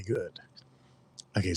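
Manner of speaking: ordinary talk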